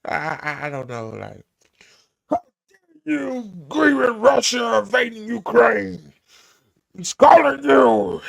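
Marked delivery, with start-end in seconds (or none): 2.3-8.2 high, loud hammed-up outrage; mocking senator. Streamer parodying the senator's scolding, high mock outrage.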